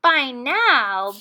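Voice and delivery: plain reading voice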